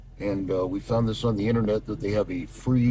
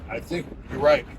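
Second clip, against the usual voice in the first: shouting over wind; 7 semitones higher, 6 dB louder than usual. Real, not acted. Calling out in wind.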